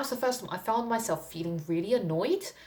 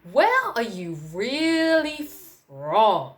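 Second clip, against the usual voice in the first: slow, high, louder probing mock voice; pushy lady; 5 semitones higher, 5 dB louder, much slower than usual. The European lady pressing her, slow high mock probe. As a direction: drag out the prying question. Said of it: "she gave me another look and she said".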